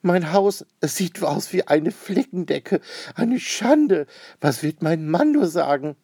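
high, loud acted lament; dismayed. A narrator's reading. Lady laments her patchwork house to the painters, dismayed. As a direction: wail it in high-pitched outrage. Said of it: "sie freut sich überhaupt nicht".

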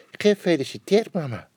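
a little bear happily wishing mama happy birthday; cheerfully wishing his mother happy birthday. A narrator's reading. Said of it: "zei Kleine Bruine Bear vrolijk".

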